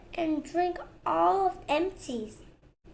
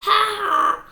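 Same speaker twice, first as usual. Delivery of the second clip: mocking laugh; 5 semitones higher, 9 dB louder than usual. Mouse mocks Tom.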